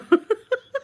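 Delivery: helpless high-pitched laugh; can't keep a straight face, laughing high; high squealing laugh. Real, not acted. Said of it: "I can't even say it with a straight face".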